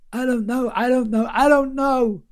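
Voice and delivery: high, panicked, repeated pleading. Acted. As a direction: plead desperately, voice cracking high. Gerald panicking under interrogation.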